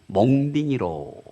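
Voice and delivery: light Gyeongsang dialect reading; a touch of Gyeongsang dialect, played for a smile; 경상도 사투리로 가볍게. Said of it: "경상도 버전에서는 뭐라고 했냐면".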